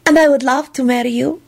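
enthusing. A narrator's reading. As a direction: accept with glad enthusiasm. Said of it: "she enthused".

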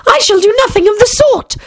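high, huffy mouse voice; offended mouse. A narrator's reading. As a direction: put on a prim, offended squeak.

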